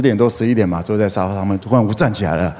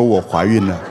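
a half-laughing imitation of a tearful confession; mock crying; 5 semitones lower than usual. Pastor warning girls not to announce pregnancy crying. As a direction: pretend to whimper the news. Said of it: "跟他哭着说".

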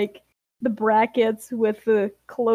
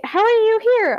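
high, louder burst of disbelief; startled, raised voice; astonished question; 10 semitones higher, 5 dB louder, much faster than usual. Acted. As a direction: ask it in acted shock, pitch shooting up. Apple is shocked to see Grape.

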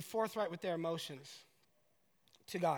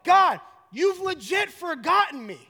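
high, loud, slow confrontation; anguished, exasperated acted outcry; shouting at God; 10 semitones higher, 13 dB louder, slower than usual. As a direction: shout up to heaven, demanding an answer. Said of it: "David right here is like".

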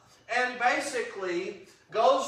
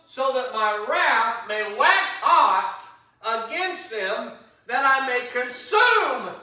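angry God; 3 semitones higher, 6 dB louder than usual. Acted. Preacher voicing God threatening Israel, loud stern wrath. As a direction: let the wrath swell on each phrase. Reading God's angry words.

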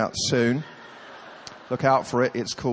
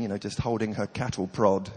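usual speaking voice

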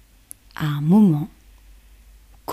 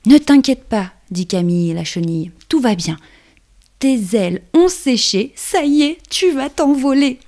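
reassuring, raised caterpillar voice; calm her down, brightly; 5 semitones higher, 4 dB louder than usual. Acted.

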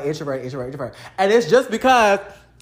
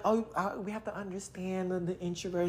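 much softer, hushed mock sympathy; mock soft earnestness; 14 dB quieter than usual. Vlogger mocking her old introvert talk, in a hushed soft voice. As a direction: murmur it like a gentle over-understanding friend. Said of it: "I'm very much like".

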